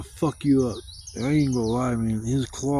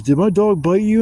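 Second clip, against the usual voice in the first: higher, louder anxious question; worried owner; 7 semitones higher, 8 dB louder than usual. Retold or imitated. Dog owner asking the bitten man. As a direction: ask urgently if he's hurt. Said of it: "and he goes".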